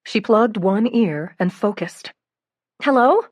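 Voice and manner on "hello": raised, straining to hear; loud hello. A narrator's reading. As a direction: call out over the noise. Answering a noisy call.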